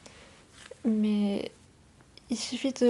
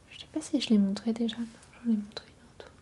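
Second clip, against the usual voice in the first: voix chuchotée douce et proche; chuchotement ASMR. Acted. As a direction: chuchoter tout près du micro. ASMR whisper with play-dough. (murmure ASMR)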